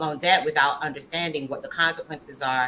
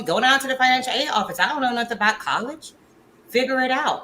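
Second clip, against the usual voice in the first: lightly voiced mother, higher pitch; higher-pitched retold parental brush-off; imitating a dismissive mom; 7 semitones higher, faster than usual. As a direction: brush the kid off like a busy parent. Voicing mom's brush-off about college.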